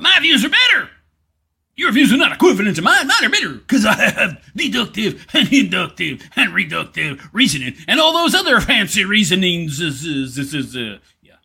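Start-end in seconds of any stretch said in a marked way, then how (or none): 0.0-9.7 fast, gabbled parody of a smug debater; laughing, sing-song mock superiority; mock boasting. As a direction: brag in a mocking rush. Mimicking opponent boasting of reasoning.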